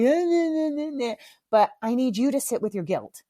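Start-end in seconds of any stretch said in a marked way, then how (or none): 0.0-1.2 high singsong mock taunt. Mom showing what she won't do to her son, taunting. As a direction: rub it in with a childish nah-nah.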